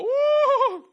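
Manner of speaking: loud, high whoop; excited woo. Retold or imitated. People reading Revelations, loud excited whoop. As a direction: whoop like it thrills you.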